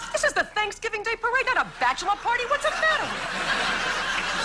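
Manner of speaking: high, laughing indignant retort; mock indignant. Comedian scolding a float driver in laughing mock outrage.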